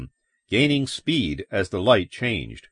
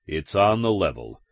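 confirming cop; 2 semitones lower than usual. Acted. Cop reassuring the man it's on the level, even. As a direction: state it back evenly, without excitement.

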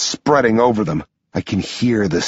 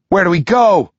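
urgent raised shout; 8 semitones higher, 4 dB louder than usual. Acted. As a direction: yell the question over the chaos. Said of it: "I shout".

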